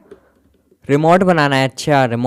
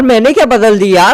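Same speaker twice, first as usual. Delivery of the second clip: ordinary talk, nothing marked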